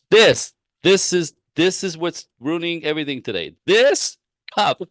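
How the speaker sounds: imitating husband's frustrated rant over a cup. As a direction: play the fed-up husband lifting the cup. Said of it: "So very frustrated".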